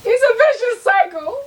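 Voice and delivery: high wailing mock sob; hammed-up fake crying. Mock despair about bag.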